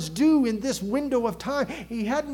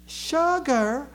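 higher, incredulous Southern drawl; Southern accent; 5 semitones higher than usual. Acted. Southerner startled at being asked about sugar, Southern drawl. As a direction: echo it back in a mock Southern accent. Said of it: "Can you imagine that accent".

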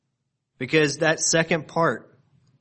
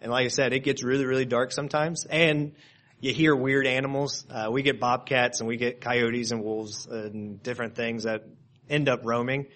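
ordinary talk, nothing marked

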